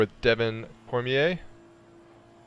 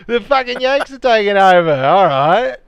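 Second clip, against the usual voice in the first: shouted, high-pitched hammed-up Aussie; mock Australian accent; 11 semitones higher, 12 dB louder, slower than usual. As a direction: holler it in a bad Aussie accent. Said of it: "they appreciate your accents".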